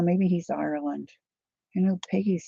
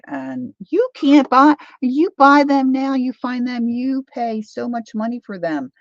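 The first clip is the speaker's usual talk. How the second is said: worked-up complaint; 8 semitones higher, 6 dB louder than usual. Real, not acted. Complaining about blanket prices.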